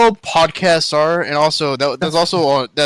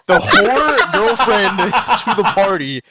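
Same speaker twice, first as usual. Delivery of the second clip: helpless laughing while talking; slow, laugh-broken speech; real laughter; 4 semitones higher, much slower than usual. Real, not acted. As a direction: push the words out through laughter. Breaking up laughing mid-sentence.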